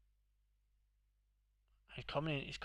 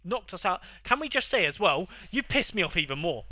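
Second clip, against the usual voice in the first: louder, higher, exasperated outburst; angry rant; 6 semitones higher, 11 dB louder than usual. Real, not acted. Ranting at the friend who knocked them out, loud and exasperated. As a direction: vent it loudly at your mate.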